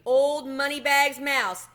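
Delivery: slow, loud, teasing title; cat mocking the rich mouse; mocking nickname. A narrator's reading. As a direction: drawl out the nickname to tease.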